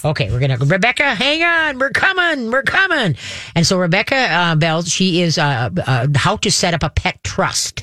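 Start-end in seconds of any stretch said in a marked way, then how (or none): 0.5-3.2 calling out. Real, not acted. Calling out to waiting caller.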